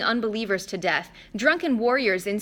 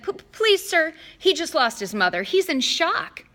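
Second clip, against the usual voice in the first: higher, stammering plea; nervous plea; 8 semitones higher, 4 dB louder than usual. Acted. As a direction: beg him nervously. Grover pleads nervously.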